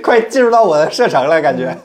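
normal talking voice

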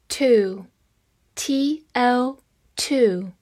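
to, spelled T O, each letter slow and clear; slow spelled-out word. Shown as a demonstration. Teacher spells to for learners, slow and careful. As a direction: drill the spelling of to, slowly. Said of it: "它有很多发音".

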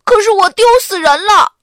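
high, loud anguished yell; loud cry. Acted. Arthur yelling in embarrassment at the lunchroom, loud high. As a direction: shout in humiliation. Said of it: "亚瑟大声地喊着".

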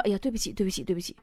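小声赔不是; 连声道歉. Retold or imitated. Manager apologizes to angry mother. (模仿经理低声下气地连连道歉)

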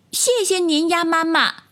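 put-on high-pitched childlike tadpole voice; little tadpoles asking. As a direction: ask politely as a tiny tadpole, high. Tadpoles thank mother duck. (小蝌蚪用稚嫩高音询问)

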